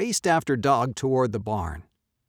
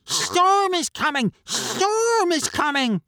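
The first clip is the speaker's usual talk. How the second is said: very high duck voice; quacking duck; 5 dB louder, slower than usual. A narrator's reading. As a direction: quack out the warning. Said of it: "Duck quacked".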